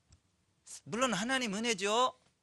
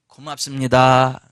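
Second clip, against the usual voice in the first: slow, lower, sincere thank-you; modeled thanks; 6 semitones lower, 11 dB louder, slower than usual. Shown as a demonstration. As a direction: say thank you slowly, from the heart. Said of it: "다른 사람의 은혜를 깨닫고". (천천히 진심을 담아 하는 감사 인사)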